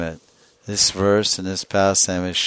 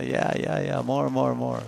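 higher, unshouted egging-on; play-acted gleeful appetite for more; mock eagerness; 5 semitones higher, 4 dB quieter than usual. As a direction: urge them on, relishing the abuse. Acted relish at being abused.

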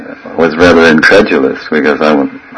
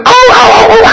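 very high yelping like a dog faking pain; laughing mock dog howl; fake dog yelps. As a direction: yelp up high as a dog pretending to be hit. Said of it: "the dog starts acting".

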